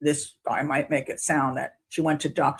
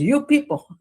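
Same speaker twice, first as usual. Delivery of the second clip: higher, louder indignant jab at the jury; mock outrage, pitched up and loud; angry lawyer; 9 semitones higher, 5 dB louder than usual. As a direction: imitate a furious attorney scolding. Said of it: "he continues with his anger".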